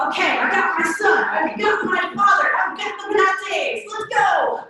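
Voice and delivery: eager rush. Retold or imitated. Aeneas fleeing Troy, forgetting his wife, mock excited. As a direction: play a hero hurrying off, pleased with himself. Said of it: "He's like".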